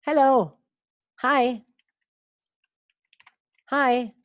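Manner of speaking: teacher modelling hi; English hi. Shown as a demonstration. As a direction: say hello, hi with a long clear English hi. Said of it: "que se pronuncia hi".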